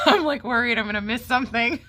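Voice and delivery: real laughter, eyes on the hawk; laughing. Real, not acted. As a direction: giggle it out nervously. Laughing while watching the hawk.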